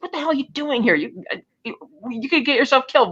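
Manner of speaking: a hint of a scared woman hissing a warning; imitating Lupe. Lupe whispering a warning to Bond.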